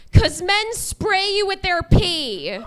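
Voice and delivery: loud, high, slow indignant holler; hammed-up outraged audience yell; mimicked heckler yell. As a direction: imitate a woman shouting from the back. Mimics the yelling woman heckler.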